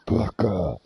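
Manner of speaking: Parker said low in a bass voice; extremely deep bass voice. Pretend. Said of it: "Voll die tiefe Stimme danach".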